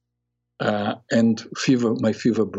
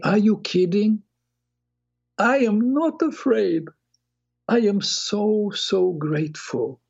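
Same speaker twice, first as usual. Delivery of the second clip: high, emotional voice, close to tears; reliving the moment, choked up and pleading; tearful; 10 semitones higher than usual. Retold or imitated. Telling the loving blackness he is not afraid, high and tearful.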